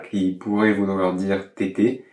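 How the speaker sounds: ordinary talk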